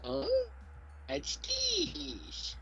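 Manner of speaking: high, wondering little voice; playful high kitten impression; curious kitten voice. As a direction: echo the kitten's puzzled question. Echoes kitten voice.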